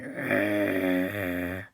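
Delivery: deep rumbling growl; low monster growl. Acted. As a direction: growl like a ghost dinosaur. Ghost growl.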